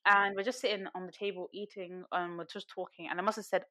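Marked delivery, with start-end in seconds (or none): none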